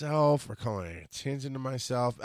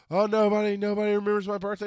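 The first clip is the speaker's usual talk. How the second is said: high, louder whine; mock crying; 8 semitones higher, 4 dB louder than usual. Friend answers birthday call feeling forgotten, mock wailing. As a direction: imitate a self-pitying friend wailing. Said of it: "she's crying".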